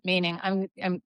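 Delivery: normal talking voice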